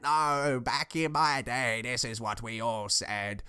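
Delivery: lightly voiced old relative, drawn out; a hint of a set-in-their-ways elder, slowing down. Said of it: "old relatives ... they say things".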